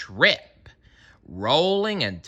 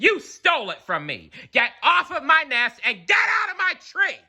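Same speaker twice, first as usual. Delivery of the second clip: very high laughing shout; 14 semitones higher, faster than usual. A narrator's reading. Maisie driving Horton off the nest, high shout. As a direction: yell it up high, breaking into laughter. Sorry if that was too loud.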